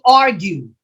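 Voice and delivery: AR stressed in "argue", falling away after it; first-syllable stress. Shown as a demonstration. Teacher sounding out option C, "argue" stressed on first syllable. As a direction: say "argue" as AR-gue. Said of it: "First syllable".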